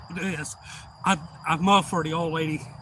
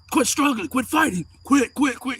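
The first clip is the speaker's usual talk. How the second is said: loud, high repeated orders; imitating an officer yelling commands; acted cop commands; 8 semitones higher, 6 dB louder than usual. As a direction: shout orders at someone struggling.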